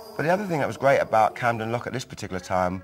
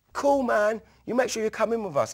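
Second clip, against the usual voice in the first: friend's easy invite; 11 semitones higher than usual. Retold or imitated. Jazzy invites him in.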